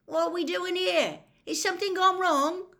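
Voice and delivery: put-on high, loud confused boy; bewildered lad calling out, pitched high; Ron's voice. A narrator's reading. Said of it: "Ron's voice now joined".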